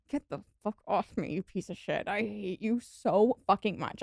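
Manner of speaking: mock inner rant. Venting imagined inner anger at her sweet cat, muttered. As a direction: grumble it under your breath. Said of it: "I'm like".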